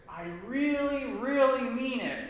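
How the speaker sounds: both reallys stretched high and loud; emphatic repetition. Shown as a demonstration. Teacher showing emphasis, stretched and loud. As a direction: lean hard on each really, really. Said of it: "repeat ourselves too to show emphasis".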